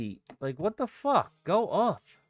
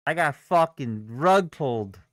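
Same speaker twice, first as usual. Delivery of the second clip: ordinary delivery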